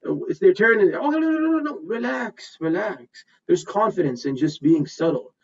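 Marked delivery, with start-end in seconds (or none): 0.9-3.2 calming nervous speaker. Pretend. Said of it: "they're so nervous".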